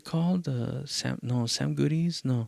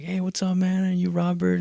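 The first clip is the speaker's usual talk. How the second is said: lightly voiced host, pitched up; imitated host greeting; 8 semitones higher than usual. Show host meeting the performer, lightly imitated greeting. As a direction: give a high, casual hello as someone else.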